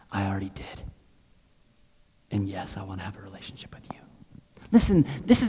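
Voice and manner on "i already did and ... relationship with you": God's reply, very soft and hushed, ending in a whisper; quiet voice of God. Acted. Preacher voicing God's hushed reply to the sinner. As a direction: gently whisper God's answer.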